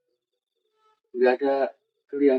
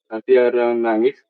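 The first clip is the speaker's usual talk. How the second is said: ordinary talk, nothing marked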